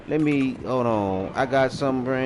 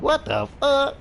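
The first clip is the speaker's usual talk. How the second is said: mock outrage; 14 semitones higher than usual. Reacting to couch bedding.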